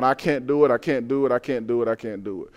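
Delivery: fading refusal. Retold or imitated. Moses refusing God, fading to a murmur. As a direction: let the refusal die away.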